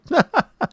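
high-pitched burst of laughter; Baron's tickled laugh. A narrator's reading. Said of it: "the first time the Baron had laughed".

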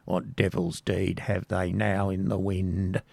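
low, soft muttering; murmuring to herself. Acted. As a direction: murmur it under your breath. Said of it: "murmuring to herself".

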